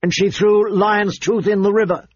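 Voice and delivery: insistent accusation from a prince; Joffrey insisting. Acted. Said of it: "Prince Joffrey insisted".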